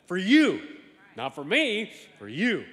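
preacher shout on for you. Acted. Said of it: "preaching emphasis".

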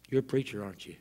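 soft, hushed question trailing away. Acted. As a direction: ask it gently, dropping away at the end. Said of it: "His voice lowers and softens".

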